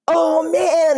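high mocking groan of kids on the bleachers; mocking classmates. Classmates mocking her in choir, high teasing groan. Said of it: "students were like".

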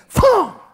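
sharp high shouted impact noise; punchy sound effect for a strike; shouted thump. Shown as a demonstration. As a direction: bark out the hit sound. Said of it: "He could be coming at me".

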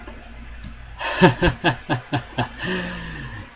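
high, stuttering laugh trailing into a long note; giggling laugh. Real, not acted. Gamer amused by his own act, high giggle. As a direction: break into a high drawn-out giggle.

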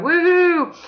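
high cheering woohoo; a joyful cheer; excited woohoo. Real, not acted. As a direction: whoop with joy. Cheering, blowing bubbles.

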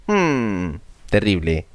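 usual speaking voice

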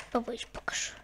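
unvoiced whisper. Real, not acted. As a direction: whisper the promise. Whispered aside.

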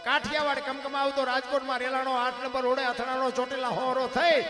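loud, rhythmic chanted recital of a ballad; fiery dayro-style recitation of a village poem; folk poem recitation. Acted. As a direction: declaim each alliterative line in a driving rhythm, rising to a shout at the end. Recites Bhikhabhai Rabari's earthquake poem.

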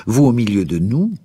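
shaken, indignant question; deeply affected tone. A narrator's reading. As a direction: draw it out upward in disbelief. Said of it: "reprit-il d'un ton pénétré".

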